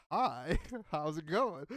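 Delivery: slow, loud hello broken by a laugh; mock casual greeting. Greets her from where he fell, mock casual. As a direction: play it casual, laughing midway.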